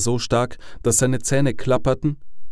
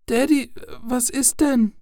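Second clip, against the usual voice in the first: put-on very high, slow child voice; small, puzzled daughter's question; little girl; 14 semitones higher than usual. A narrator's reading. Daughter asks father what is wrong, little girl voice.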